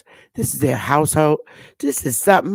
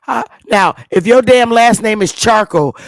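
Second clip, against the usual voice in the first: joking excitement; 5 semitones higher, 6 dB louder than usual. Real, not acted. Mocking joke about surname.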